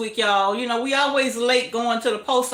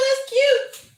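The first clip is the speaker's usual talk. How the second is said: delighted laugh; 13 semitones higher, slower than usual. Real, not acted. Delighted by Oklahoma viewer.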